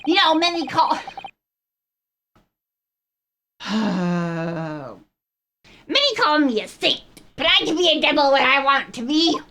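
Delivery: high, taunting villain voice; menacing prosecutor. Acted. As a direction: sneer it like a smug villain.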